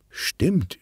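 high, musing agreement; überlegend. A narrator's reading. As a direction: agree while thinking it over. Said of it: "überlegt Lea". (nachdenklich, in hoher Stimmlage)

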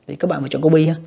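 ordinary delivery

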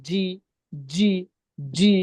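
জি said hard and thick; heavy zi. Shown as a demonstration. Teacher showing the class the heavy letter. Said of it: "মোটা করে".

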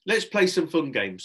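put-on rally the children as if all is fine; breezy cover-up; forced cheer. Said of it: "acting as if nothing had happened".

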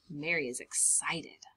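hushed whisper of suspense. Acted. Building suspense for the children in a hushed whisper. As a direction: lean in and whisper the secret. Excited secret aside.

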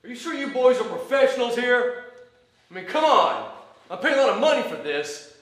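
furious, loud complaint; angry shouting. Acted. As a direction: yell at the performers. Owner berates the performers.

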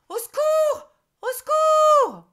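very high, very loud shriek for help; witch screaming. A narrator's reading. Terrified witch shrieking for help at the toad. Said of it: "Carabossu se met à crier".

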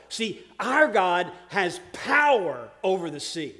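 loud, emphatic sermon delivery; full-force preaching. Real, not acted. Preacher telling the congregation of God's power, full-force preaching. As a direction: preach it loud, leaning on key words.

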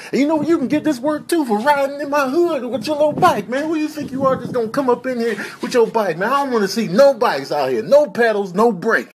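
high, agitated comic rant; hammed-up threat. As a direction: chase off an intruder, mock outrage.

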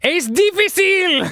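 mock Sergio. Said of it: "Says Sergio".

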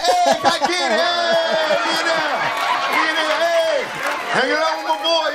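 hollering into the phone like a proud new buddy; hyped-up Snapchat voice. Retold or imitated. Comedian shouting into his Snapchat at the game, hyped.

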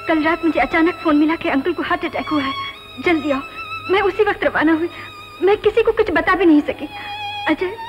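distant crackly phone voice; desperate plea down a phone line; over the phone. Acted. Distressed caller over trunk line. (Phone पर रोती हुई आवाज़)

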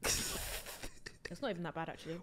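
soft, slow whisper; hushed surprised verdict; whispered aside. Real, not acted. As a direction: whisper it like a secret.

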